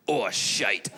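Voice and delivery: put-on low Scottish-accented dismay; Edinburgh heckler. Edinburgh heckler hearing about the artificial foot, mock Scottish dismay. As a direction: imitate a Scot realising his blunder, voice dropped. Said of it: "One was in Edinburgh".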